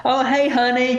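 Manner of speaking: high-pitched mock girlfriend hello; acted sugary, high greeting; put-on sweetheart greeting. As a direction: play up being the girlfriend. Acting the pretend girlfriend's greeting.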